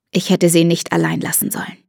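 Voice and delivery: ordinary delivery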